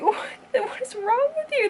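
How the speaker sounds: mock outrage. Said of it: "and now I'm like".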